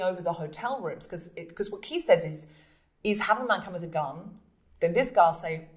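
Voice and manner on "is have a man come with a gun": ordinary delivery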